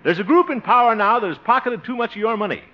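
high, loud stump speech; campaign oratory. Pretend. Candidate rehearsing his speech to voters, speechifying. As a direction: project to a rally, starting high and loud.

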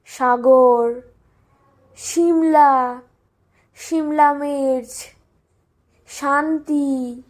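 Shagor, Shimla, Shanti all said with sh; every s turned into sh; Bengali sh sound. Shown as a demonstration. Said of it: "we have all Sha".

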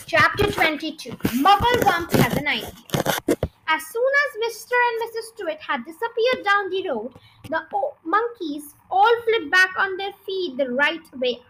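lively read-aloud storytelling voice; story narration. Acted. Reading The Twits chapter to viewers, lively narration. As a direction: read it like a children's book to an audience.

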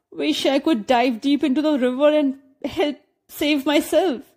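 mildly tearful, wishing to escape; understated helpless sob in the voice; helpless weeping. A narrator's reading. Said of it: "wept Jasmine helplessly".

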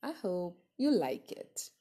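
ordinary delivery

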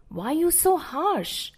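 lightly acted family reproach; mild reproach. A narrator's reading. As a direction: question the parent as a concerned relative. Relatives reproach the parent.

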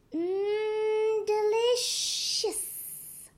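high, very slow, savouring; exclaiming. A narrator's reading. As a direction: stretch out a fake yum. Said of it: "he exclaimed".

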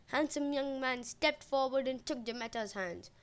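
plain reading voice

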